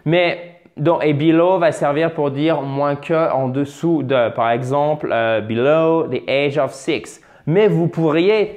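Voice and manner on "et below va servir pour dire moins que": ordinary delivery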